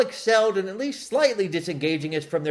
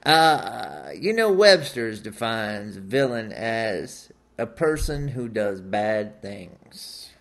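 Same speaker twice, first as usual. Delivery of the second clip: Matthew McConaughey impression; 10 semitones lower than usual.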